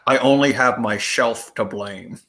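low, slow impression with slushy s sounds; slow Scottish movie-star lisp; Sean Connery. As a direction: draw it out low, S turning to SH. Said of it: "I can do a Sean Connery".

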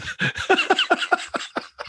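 high, breathless wheezing laugh; real helpless laugh that sounds like crying; squeaky cry-laugh. Real, not acted. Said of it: "I'm doing that crying that sounds like a straw".

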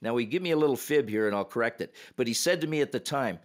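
usual speaking voice

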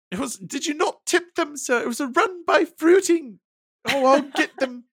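put-on very high old-lady falsetto; Mrs Doubtfire. Acting out the nanny fumbling the famous line, very high falsetto. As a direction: squeak it out like a flustered old nanny. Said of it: "Missus Doubtfire says the word dear".

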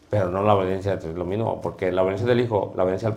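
muttering aside. Real, not acted. Teacher muttering to himself.

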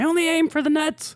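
squeaky high character voice; high cartoon voice. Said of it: "he says".

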